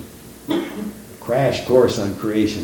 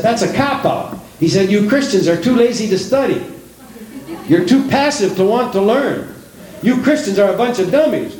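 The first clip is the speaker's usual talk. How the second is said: imitating a scornful man, pitched up and raised; mock angry atheist; 8 semitones higher than usual. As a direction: mimic the heckler's sneering tirade. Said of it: "he's raising his voice".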